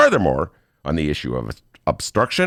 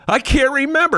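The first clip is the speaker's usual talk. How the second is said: much higher, louder mock amnesia; mock forgetful Trump; 5 dB louder than usual. Host mocking the president's faked forgetfulness, higher and louder. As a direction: play the convenient forgetter. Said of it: "convenient case of amnesia".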